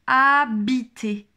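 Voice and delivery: slow, emphatic dictation. Shown as a demonstration. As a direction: project each syllable slowly for the pupil. Teacher repeats test word.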